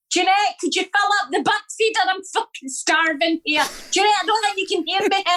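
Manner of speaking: high squeaky animal voice; a needy squirrel calling up to the house; talking squirrel. Acted. Squirrel calling for Jeanette to fill the feeder, squeaky high. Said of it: "the wee one with the squirrel going".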